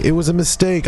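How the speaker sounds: high, whiny fake apology; mock plea. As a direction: beg for mercy in a put-on high voice.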